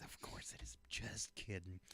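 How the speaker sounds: mock whispered echo; whispering. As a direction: whisper it like a tease.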